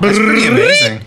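high, loud hammed-up wonder ending in a trill; mock amazement. Reacting to a site dated tomorrow, hammed-up high loud amazement. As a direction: overplay the awe for laughs.

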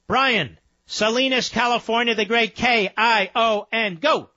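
high-pitched radio host announcing a caller; calling up a caller. Real, not acted. Host calls up next caller.

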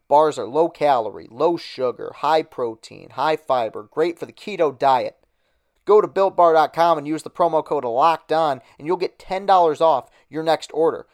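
punchy sponsor read, leaning on each selling point. Acted. Podcast host reading a sponsor ad to listeners, upbeat ad read. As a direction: sell the snack bar like a radio spot.

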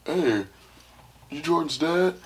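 put-on high, loud, challenging kid's voice; teasing friend voice. Schoolkid asking Jordan's dad, in a high, loud, teasing voice. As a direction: call out the dad like a nosy schoolkid.

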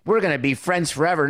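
mock the big movie confession; mock rom-com gush. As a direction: overblown rom-com declaration. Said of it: "come to Jesus and say".

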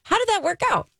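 high-pitched, sing-song feigned curiosity; mock-innocent question. Mother quoting her therapist's line, mock-innocent. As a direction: ask it sweetly, voice way up. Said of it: "I go".

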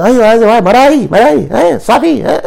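high, loud cry of recognition; loud mock delight; imitated excited relief. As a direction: act out a customer finally understood, voice shooting up. Retold customer relieved at being understood.

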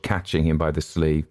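usual speaking voice

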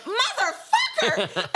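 high, slow, drawn-out curse; retold swearing. Cursing as the wine bottle smashes, loud and laughing. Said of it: "I went".

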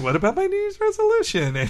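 high whiny cartoon dad voice; whiny Homer voice. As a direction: squeak it out like a childish Homer. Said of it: "He's like".

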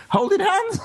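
words squeaking up high into laughter; helpless laughter. Real, not acted. Ghost hunter recalling huddling in the ladies' toilets, helpless laughter. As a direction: crack up at your own story. Breaks up at his own story.

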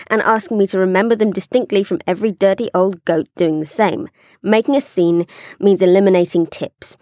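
even, measured read-aloud voice; letter reading. Acted. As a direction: read the advice-seeker's letter evenly. Reads listener's letter aloud.

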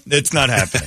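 usual speaking voice

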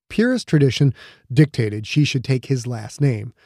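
usual speaking voice

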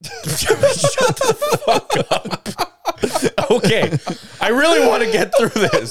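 laughing through the words; can't stop giggling. Real, not acted. Laughing fit while reading news.

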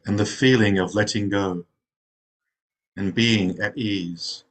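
unhurried meditation guide, long pauses between phrases; guided meditation. Real, not acted. Zen teacher settling meditators into rest, guided meditation voice. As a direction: ease them into rest, phrase by phrase.

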